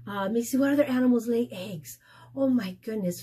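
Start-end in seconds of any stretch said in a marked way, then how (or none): none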